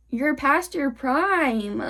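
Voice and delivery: slow, stretched-out jab; drawn-out taunt. Real, not acted. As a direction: drag the words out to taunt. Taunting older people, first take.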